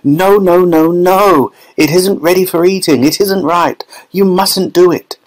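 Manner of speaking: high, pleading, anxious protest; frantic Wonka. A narrator's reading. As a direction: beg her to stop. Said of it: "wringing his hands and saying".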